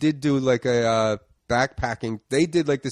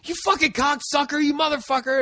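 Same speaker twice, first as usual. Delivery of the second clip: much higher, furious shouted insults; road rage yell; 14 semitones higher than usual. Retold or imitated. Yelling at the driver beside him, furious high insults. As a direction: scream it at the driver you just pulled up beside. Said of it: "was like".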